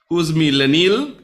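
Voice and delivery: usual speaking voice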